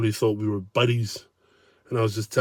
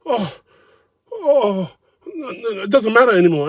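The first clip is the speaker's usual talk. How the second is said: much higher, flustered backing off; higher flustered whine of a cornered diner; flustered mock retreat; 9 semitones higher than usual. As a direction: squirm out of the argument. Man backing down, mocked.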